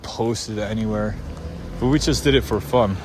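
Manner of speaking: normal talking voice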